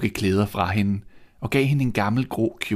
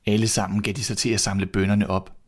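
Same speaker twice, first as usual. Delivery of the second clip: ordinary delivery, nothing marked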